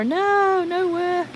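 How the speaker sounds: high, drawn-out objection; playful protest. Real, not acted. Streamer to viewer mentioning work tomorrow, playful protest. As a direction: whine it high in refusal.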